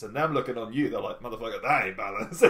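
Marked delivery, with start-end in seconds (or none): 1.2-2.4 laughing mock scoff. Quoting partier judging athlete, laughing.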